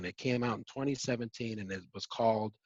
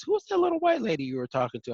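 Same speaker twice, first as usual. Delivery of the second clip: friend's question; 11 semitones higher, 3 dB louder, faster than usual. Retold or imitated. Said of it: "He says".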